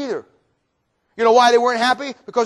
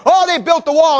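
higher, scoffing exclamation; mocking jeer; 7 semitones higher, 4 dB louder than usual. Scoffers making fun of the wall, mocking. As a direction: jeer at the builders' work. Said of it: "they're making fun".

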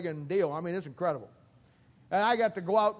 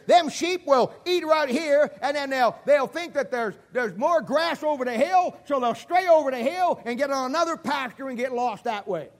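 put-on old shepherd voice; 6 semitones higher, 7 dB louder than usual. Preacher voicing old shepherd.

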